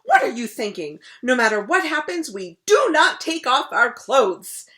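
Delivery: loud scolding shout; king scolding the crocodile. Acted. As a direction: scold loudly. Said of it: "he shouted".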